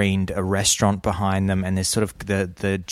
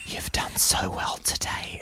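soft breathy ASMR whisper, slow; 8 dB quieter, slower than usual. Retold or imitated. Retelling an ASMR video to a friend, whispered. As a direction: hush it like a soothing video voice.

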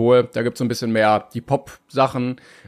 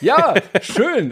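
laughing; 8 semitones higher, 3 dB louder than usual. Real, not acted. Laughing while greeting co-host.

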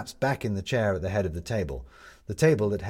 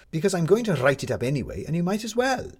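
high-pitched, pushy persuasion; emphatic, rising insistence; insistent Maddie; 10 semitones higher, 4 dB louder than usual. A narrator's reading. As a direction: press the argument, pitch climbing. Maddie argues him into talking.

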